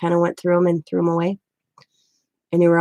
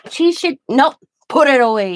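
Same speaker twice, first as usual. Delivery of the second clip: much higher and louder, mock stern old man; stern grandfather; 6 semitones higher, 6 dB louder than usual. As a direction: cut the doctor off with a firm no. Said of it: "He'd have that tone".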